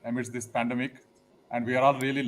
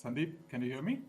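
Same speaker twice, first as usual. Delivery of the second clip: very faint, far from the mic; hardly heard over the call; barely audible; 18 dB quieter than usual. Real, not acted. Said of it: "Your voice is very low".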